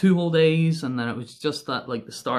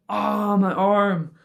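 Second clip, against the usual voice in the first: drawn-out, higher "oh" of pain; mock pained cry; 6 semitones higher, 4 dB louder, slower than usual. Rider retelling a bike crash, mock pained cry. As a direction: wail it out, retelling the hurt.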